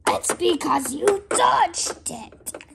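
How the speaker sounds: high, loud, drawn-out villain retort; play-acted indignation. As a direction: protest loudly as the thwarted villain. Green Goblin argues back in play.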